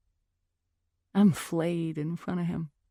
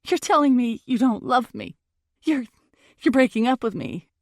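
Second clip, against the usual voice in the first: loud, high, mounting accusation; angry accusing; 4 semitones higher, 5 dB louder than usual. Acted. Girlfriend accusing boyfriend of dumping her, loud and furious. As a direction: build to a furious insult.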